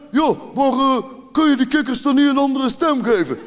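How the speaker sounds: breezy know-it-all doctor; doctor's suggestion. Retold or imitated. Throat doctor suggests changing the frog voices, casually. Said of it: "En toen zei hij van".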